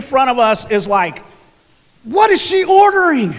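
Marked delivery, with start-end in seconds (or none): 2.0-3.3 high-pitched mock exasperation; exasperated griping. Retells griping in the coffee line.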